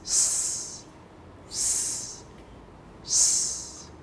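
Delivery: long hissing sss; snake hiss. Shown as a demonstration. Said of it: "And it goes like this".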